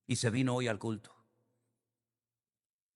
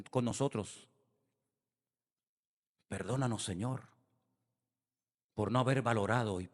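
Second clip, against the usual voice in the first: leading a prayer. Real, not acted. Pastor guiding the congregation to ask forgiveness, in a hushed prayer. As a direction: pray aloud on their behalf, humbly.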